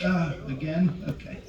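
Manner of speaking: slow, reluctant husband impression; resigned husband. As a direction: give in with a drawn-out groan. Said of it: "Roger's like".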